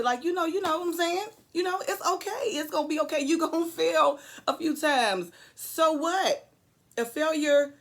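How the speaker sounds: real tears breaking through the encouragement; holding back tears. Real, not acted. Tearing up while encouraging viewers.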